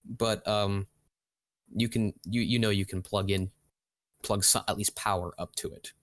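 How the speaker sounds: usual speaking voice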